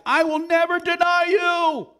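high, drawn-out shouted oath; emphatic vow. Acted. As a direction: shout it slowly, absolutely insistent. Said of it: "he was so emphatic".

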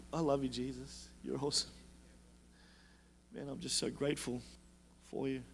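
low, very soft prayer; intimate talk to God. Acted. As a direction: quietly tell God you love him, barely projecting. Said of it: "that's how I talk to God".

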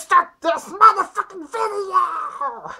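shouted, slow, high Penguin voice; hyped shout. Acted. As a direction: yell it out to kick off the show. Penguin yelling to start video.

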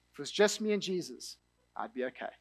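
hushed and heartfelt; quiet vow. Retold or imitated. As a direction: murmur it as a sincere promise. The same vow, quietly repeated.

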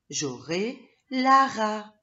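teacher announcing the new word "lara"; slow emphatic reading. Shown as a demonstration. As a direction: land on "lara" slowly and clearly.

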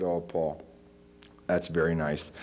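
put-on old teacher.

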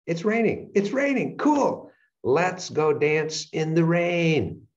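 very high-pitched, giddy cheer, stretched out at the end; gleeful mock excitement. Adapting happily to unexpected rain, gleefully excited. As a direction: squeal it with hammed-up delight. Same news, now welcomed with delight.

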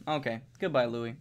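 usual speaking voice

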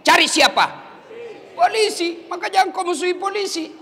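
ordinary delivery

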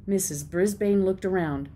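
ordinary delivery

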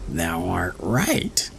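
the fox agreeing slowly; sly fox. A narrator's reading. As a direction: answer slyly. Fox answers Tom.

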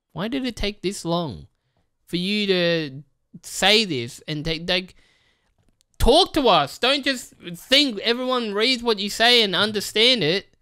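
rising to a high, loud demand; mock-angry viewer yelling at YouTube; fed-up viewer. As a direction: call YouTube out, building to a shout. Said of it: "And it's like".

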